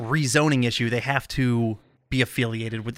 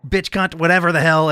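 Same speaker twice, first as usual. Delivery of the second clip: excited swearing quote; 5 semitones higher, 3 dB louder than usual. Retold or imitated. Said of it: "and says".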